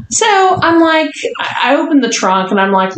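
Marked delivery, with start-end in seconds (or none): none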